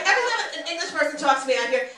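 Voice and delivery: ordinary talk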